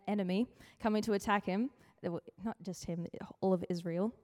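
ordinary talk, nothing marked

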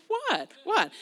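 high baffled what. Retold or imitated. Reacting to honking driver.

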